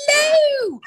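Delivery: high, loud hammed-up British "Hello!"; overdone American take on a British hello; mock English accent. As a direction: squeal it like a fake English toff. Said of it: "like, an English accent".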